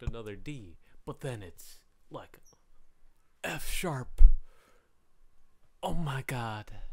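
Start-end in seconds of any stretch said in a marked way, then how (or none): 3.4-4.2 loud gasping whisper of surprise; surprised exclamation. Pretend. Mock surprise at unexpected note. 5.8-6.8 loud whispered disbelief; mock shock. Mock surprise at unexpected note.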